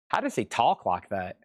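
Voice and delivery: ordinary talk, nothing marked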